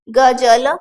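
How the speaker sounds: गजल letter by letter, ga-ja-la with hard j; loud, split गजल into three syllables; spelt-out j. Shown as a demonstration. Teacher spelling with basic Hindi letters, गजल as ga-ja-la. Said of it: "बावन वर्ण है तो क्या बनेगा".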